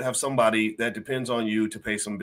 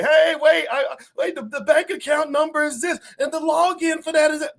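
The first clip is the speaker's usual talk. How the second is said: much higher, frantic pleading at the gates; mock panicked scream; 4 dB louder than usual. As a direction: squeal it out like a panicking soul. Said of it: "you're screaming like".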